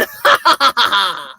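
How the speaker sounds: gloating comic-book villain laugh; evil villain cackle. Acted. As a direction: cackle like the Green Goblin. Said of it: "did you like my Green Goblin?".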